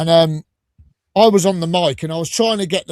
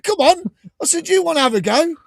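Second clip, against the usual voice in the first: mock challenge; 11 semitones higher than usual. Daring the heckler to try.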